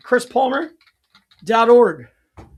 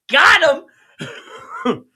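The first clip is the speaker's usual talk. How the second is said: triumphant; 6 semitones higher, 9 dB louder than usual. Real, not acted. Triumph after fixing the bug.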